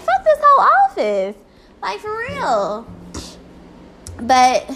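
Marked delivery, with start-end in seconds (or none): 0.0-1.4 high, loud angry exclamation; fed-up outburst. Retold or imitated. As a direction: blurt it out in disgust. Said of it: "I was just like".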